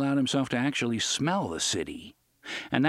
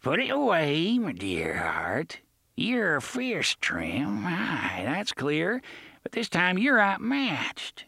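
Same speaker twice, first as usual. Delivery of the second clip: put-on thick pirate accent; 6 semitones higher, slower than usual. A narrator's reading. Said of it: "in an accent so thick".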